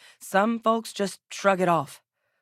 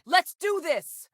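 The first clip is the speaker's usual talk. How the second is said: high, drawn-out rallying yell; rally the squad with a holler; slow, high-pitched charge cry; battle cry; 13 semitones higher, slower than usual. Acted.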